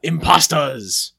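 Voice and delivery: drawn-out punchline. Acted. Punchline of a pun.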